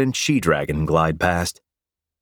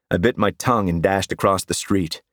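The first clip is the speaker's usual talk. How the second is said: ordinary delivery, nothing marked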